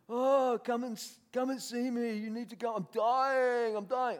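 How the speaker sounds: high, wailing mock plea; hammed-up desperate pleading. Addict on the phone begs the pastor to come, whining plea. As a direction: plead like a man acting out his deathbed.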